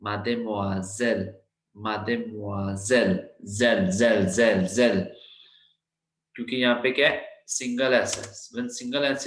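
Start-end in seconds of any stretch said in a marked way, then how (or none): 0.0-3.1 mademoiselle slowly, each syllable clear, zel buzzed like z; slow classroom demo of mademoiselle; teacher's model pronunciation. Shown as a demonstration. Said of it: "so it is mademoiselle". 3.4-5.1 zel with a buzzing z and a falling end; voiced z sound. Shown as a demonstration. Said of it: "that becomes Z sound".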